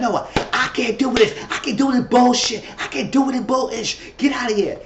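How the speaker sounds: high-pitched, worked-up imitation of storming off; upset outburst. Cornered woman walking out of an intervention, mock upset outburst. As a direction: act out someone fed up and near tears. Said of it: "that means she about to cry".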